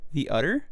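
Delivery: the utter, theater said as "the utter" with a dropped th; his own way of saying it. Shown as a demonstration. Showing a friend how he says theater, as "the utter". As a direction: run "the" into "utter", the utter. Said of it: "the utter is how I say it".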